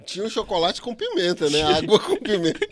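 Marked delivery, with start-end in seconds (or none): 1.6-2.7 loud laughing words. Real, not acted. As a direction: laugh through it. Laughing about the choking.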